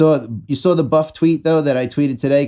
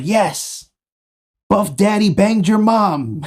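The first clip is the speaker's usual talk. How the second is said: excited tweet; 7 semitones higher, slower than usual. Retold or imitated. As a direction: crow it out triumphantly. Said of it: "I was like".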